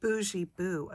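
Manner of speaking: normal talking voice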